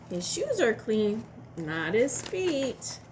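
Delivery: ordinary talk, nothing marked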